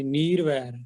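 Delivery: ਨੀਰਵੈਰ with a long "ee" in the first syllable; wrong long vowel. Shown as a demonstration. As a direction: stretch the first vowel of ਨੀਰਵੈਰ, "neer-vair". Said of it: "ਨੀਰਵੈਰ ਨਹੀਂ".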